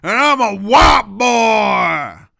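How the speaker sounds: loud hammed-up shouting, slowing down; big loud mock announcement; shouted intro. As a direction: bellow the introduction at the camera. Loud self-introduction to the camera.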